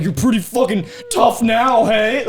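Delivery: loud, high, cocky challenge; mock taunting shout. Comic re-enacting facing an elderly heckler, loud mock bravado. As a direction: goad him with overblown bravado.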